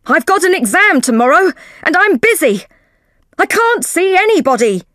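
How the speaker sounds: loud, high and irritated; shouting. Acted. McLaren shouts from behind the door, busy and loud. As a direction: keep yelling, annoyed at the interruption. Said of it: "McLaren shouted from behind the door".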